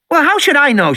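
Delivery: put-on high bratty girl voice; girl's voice. A narrator's reading. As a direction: voice the little girl, dismissive. Voicing Lex.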